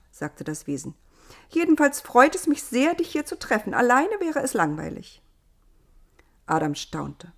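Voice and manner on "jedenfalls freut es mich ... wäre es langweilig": put-on higher, lively girl's voice; delighted Eva. A narrator's reading. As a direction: glad to have company.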